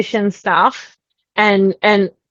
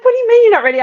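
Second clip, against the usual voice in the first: much higher, imitating an incredulous partner; baffled retold question; 3 dB louder, much faster than usual. Partner questioning her sudden refusal, retold bafflement. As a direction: pitch leaps up in mock bafflement.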